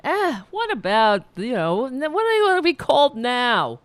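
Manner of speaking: mock him rolling his eyes at new labels; mock annoyed grumble. Coworker scoffing at new names, imitated in a high mocking voice. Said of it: "he kind of decided".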